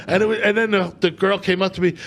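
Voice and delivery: ordinary talk, nothing marked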